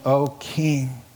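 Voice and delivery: ordinary talk, nothing marked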